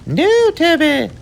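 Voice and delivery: very high, slow put-on mother voice; high falsetto mum, mock alarm; Mummy screaming. A narrator's reading. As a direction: shriek it like a horrified mum. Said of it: "screamed Mummy".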